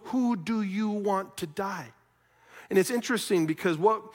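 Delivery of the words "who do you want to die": slow, weighted question; measured, probing delivery at normal volume; pointed question. Retold or imitated. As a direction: space the words out deliberately. Preacher puts Pilate's question back pointedly.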